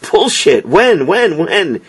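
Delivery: pushy, high repeated when; demanding. A narrator's reading. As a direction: press him for an answer, when, when. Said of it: "he demanded to know".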